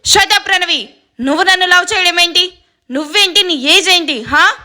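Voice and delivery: angry yell. Acted. Cousin yells at the young girl who confessed love, angry. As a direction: snap at her in fury. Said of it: "అని కోపంగా అరిచి".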